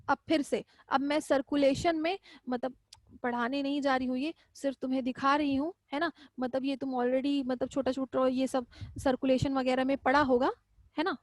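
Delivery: ordinary talk, nothing marked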